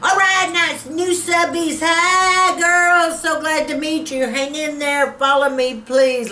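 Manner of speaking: high sweet cooing voice; between kisses. Real, not acted. Greeting new subscribers, high sweet voice.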